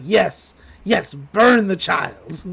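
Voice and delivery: slow, relishing mock villain; Stan's villainous voice. Schemer aiming glare at a child, slow villainous gloating. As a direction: draw it out with evil relish. Stan scheming to burn Gideon.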